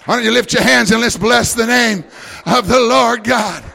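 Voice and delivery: loud, high, slow prayer; praying aloud over the music; fervent shouted prayer. Acted. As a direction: cry out to God with full force.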